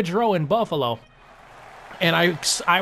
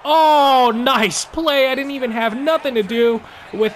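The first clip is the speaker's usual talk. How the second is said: excited; 6 semitones higher, 6 dB louder than usual. Real, not acted. Big play during hockey game.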